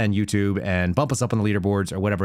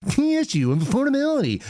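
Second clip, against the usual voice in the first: high, hammed-up repeat of the phrase; mock anchor; 13 semitones higher than usual. Mocking the news anchor's phrase in a high put-on voice. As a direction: parrot the anchor in a silly high voice.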